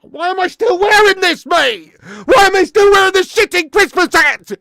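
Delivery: high, full-volume bellow of exasperation; outraged shouting. Real, not acted. Streamer ranting to viewers about the hat, furious high shout. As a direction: yell it in a real outburst, very high and loud.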